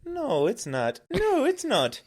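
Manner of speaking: high, indignant mock-Canadian that sounds Irish; Irish-sounding Canadian accent. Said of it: "now you're talking Irish".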